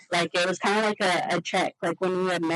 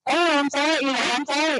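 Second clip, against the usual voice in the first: high, louder rush of apology; flustered apology; 6 semitones higher, 4 dB louder, faster than usual. Retold or imitated. Telling family she didn't realize she was crying, flustered apology. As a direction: apologise in a higher, startled voice.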